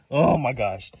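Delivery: loud burst on "Oh", then falling; loud exasperation. Real, not acted. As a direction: let the frustration burst out. Bursts out after hushed confession.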